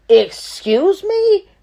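high, loud, drawn-out indignant cry; outraged yell. Retold or imitated. Woman snapping at client who orders her out, outraged yell. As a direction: act out an incredulous, furious outburst. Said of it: "I'm yelling. I'm mad as shit".

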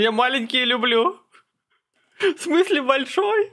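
very high-pitched joking squeal; mock startled reply. Streamer riffing on the crude reply, mock startled. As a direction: play it up in a high put-on voice.

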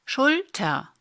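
"Schulter" with a short U, syllables set apart; short vowel. Shown as a demonstration. Teacher demonstrating vowel length, "Schulter" with short U. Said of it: "Dieses U wird kurz gesprochen".

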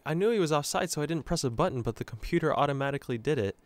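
ordinary delivery

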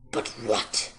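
low, rough fox growl; very low, raspy snarl. A narrator's reading. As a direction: growl it deep in the throat. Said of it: "growled the fox".

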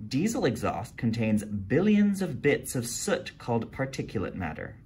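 plain reading voice

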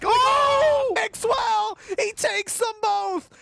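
excited shout. Real, not acted. Caster reacts to double kill.

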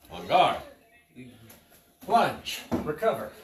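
sharp, loud drill calls; crisp fencing commands. Shown as a demonstration. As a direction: call each move to the class.

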